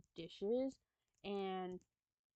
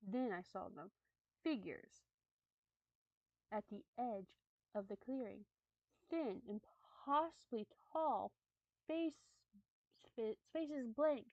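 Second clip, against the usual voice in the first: measured, slightly hushed storytelling; story narration; 4 dB quieter than usual. Acted. Streamer reads creepy camping story to viewers, storytelling voice. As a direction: read it like a campfire tale.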